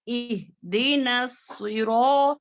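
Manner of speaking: slow सिरा with a hissing, whistled s; whistling s. Shown as a demonstration. Teacher showing the seen's whistle in the verse. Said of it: "सीन में सीटी की भी आवाज आनी है".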